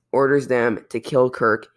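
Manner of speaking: normal talking voice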